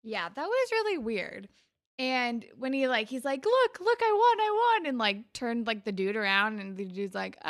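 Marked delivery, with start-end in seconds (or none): 3.4-4.9 high, loud imitation of a winner crowing; mock excited boast. Recapping the boy showing off his win, mock excited. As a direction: crow it in a raised, excited voice. Retelling a boy's boast of winning.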